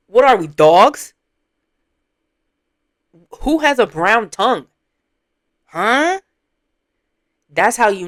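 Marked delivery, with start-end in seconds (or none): 0.1-6.2 loud, drawn-out indignant questions; incredulous outrage. Real, not acted. Streamer challenging someone over a brown tongue, incredulous outrage. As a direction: demand an answer, loud and appalled. Outraged rebuke of the clip's speaker.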